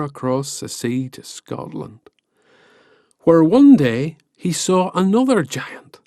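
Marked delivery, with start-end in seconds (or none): none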